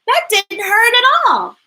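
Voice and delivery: high, loud, happy relief; surprised it was painless; relieved amazement. Acted. Boy to dentist after filling, high relieved delight. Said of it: "with amazement and relief".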